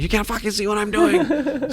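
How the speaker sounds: high squeaky mock outburst, half laughing; mock exasperated snap. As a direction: squeal it like a frazzled, overwhelmed cook. Busy Adam snaps while quoted.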